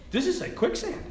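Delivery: high-pitched dawning dismay; mock alarm. Priest sinking in muck while hunting, comic alarm. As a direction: squeak out the worried realization.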